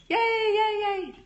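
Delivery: high squealed yays; high little cheers; excited cheer. Real, not acted. Cheering viewers' comments.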